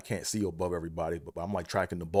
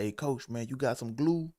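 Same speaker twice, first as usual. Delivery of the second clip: higher, desperate asking around; pleading for glue; 7 semitones higher than usual. Retold or imitated. Derek begging the equipment staff for glue, pitched high. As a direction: beg everyone around for glue. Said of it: "he's like".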